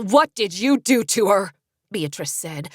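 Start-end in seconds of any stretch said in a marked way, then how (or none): 0.0-1.5 growing anger in the voice; rising anger. A narrator's reading. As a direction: let the anger build. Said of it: "anger rising in her voice".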